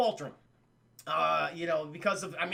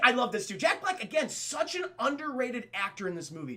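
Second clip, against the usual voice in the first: normal talking voice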